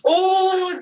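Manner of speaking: normal talking voice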